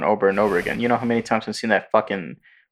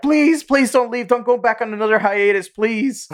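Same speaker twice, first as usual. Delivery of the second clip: mock pleading; 10 semitones higher than usual. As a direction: beg him not to leave, hammed-up. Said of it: "And people are like".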